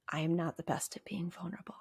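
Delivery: whispered confession, barely voiced; quietly. Real, not acted. Therapist admitting to listeners she struggles, whispering. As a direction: breathe it out like a secret you hate admitting. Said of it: "do you notice I have to say that, like, quietly".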